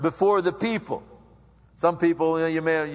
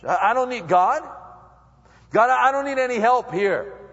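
1 proud self-reliance; 7 semitones higher, 4 dB louder than usual. Acted. Voicing a proud self-reliant believer.

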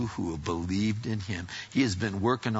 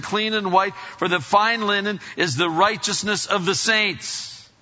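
loud, raised, declaimed Bible verse; scripture reading aloud; 9 semitones higher, 5 dB louder than usual. Acted. Reads scripture at full force.

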